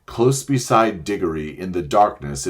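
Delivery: ordinary delivery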